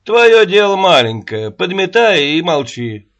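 loud scornful command; loud contemptuous order. Acted. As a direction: put her in her place, loudly. Рогачёв puts Аннушка down.

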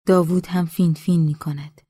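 usual speaking voice

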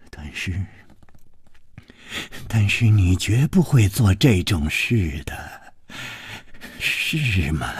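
low, hushed, faltering hoarseness; hoarse. A narrator's reading. Lover begs for denial of betrayal, hoarse and low. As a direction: plead softly in a husky low voice. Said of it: "哑着声音说".

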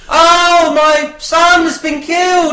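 high, loud mock wailing; grieving old woman. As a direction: cry out slowly in hammed-up grief. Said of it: "an old woman expressing her grief".

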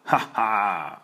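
lautes, höhnisches Schurkenlachen; böses Lachen. Acted. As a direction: lach triumphierend wie ein Bösewicht. Schurke lacht über seinen Plan. (kurzes, lautes Bösewichtlachen)

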